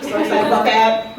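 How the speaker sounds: normal talking voice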